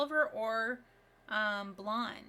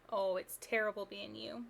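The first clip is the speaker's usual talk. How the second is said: ordinary delivery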